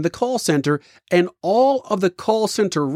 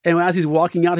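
ordinary talk, nothing marked

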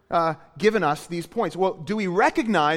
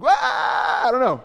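mock wordless cry; 7 semitones higher, 6 dB louder than usual. Mimicking the revived man's cry.

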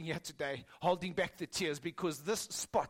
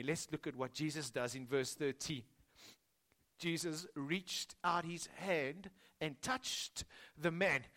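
usual speaking voice

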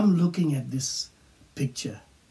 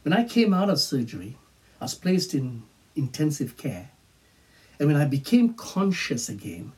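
ordinary talk, nothing marked

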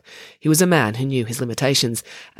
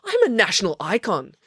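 indignant; 6 semitones higher than usual. Acted. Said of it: "yelled Maya".

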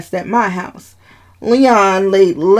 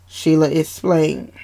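plain reading voice

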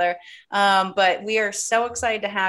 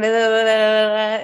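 mock rambling; 5 dB louder than usual. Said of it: "we talk so fast".

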